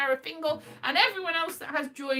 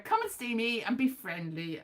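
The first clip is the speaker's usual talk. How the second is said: normal talking voice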